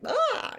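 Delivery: very high mock shriek; mock scream. Panicking before running away, high mock shriek. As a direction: squeal it and run off. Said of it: "you're just like".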